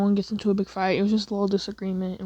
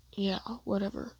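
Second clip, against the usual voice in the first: much softer, bored brush-off; dismissive attitude; 10 dB quieter than usual. Acted. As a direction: shrug it off like you couldn't care less. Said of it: "Okay, Mister Attitude".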